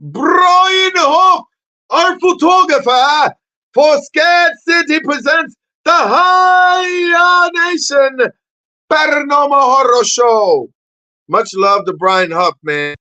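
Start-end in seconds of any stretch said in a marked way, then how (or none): 0.0-10.7 high, loud, stretched show title; announcer shout. Acted. Host announcing the horror show title to viewers, loud and high.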